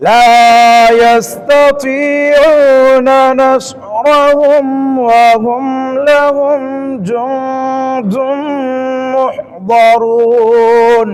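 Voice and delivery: slow drawn-out chant, words held long; tilawat recitation. Shown as a demonstration. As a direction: intone the verse slowly. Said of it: "আবার চেষ্টা করি". (ধীর সুরে তিলাওয়াত)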